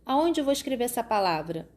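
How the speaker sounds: ordinary delivery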